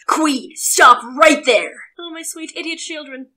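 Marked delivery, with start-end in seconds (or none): 0.1-1.7 slow, loud clunky robot voice; bad robot impression, slow and loud. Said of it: "my garbage robot voice".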